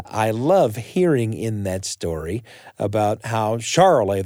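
usual speaking voice

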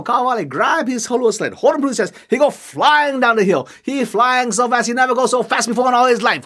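usual speaking voice

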